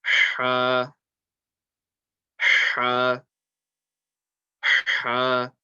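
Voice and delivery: "ha" with a strong breath from mid-throat; throaty ha. Shown as a demonstration. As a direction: push each "ha" out like heavy panting, then hold the vowel. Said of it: "is the middle of the throat".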